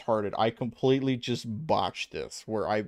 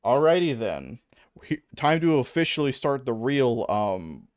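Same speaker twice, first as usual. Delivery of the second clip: normal talking voice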